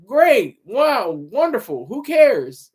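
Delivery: big swooping mock praise, slow and exaggerated; sarcastic dismissal. Real, not acted. Podcaster scoffing at talk of awards firsts, sarcastic dismissal.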